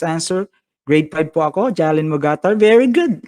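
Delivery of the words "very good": bright burst of praise, louder; excited praise. Real, not acted. Teacher cheering a grade five student, excitedly.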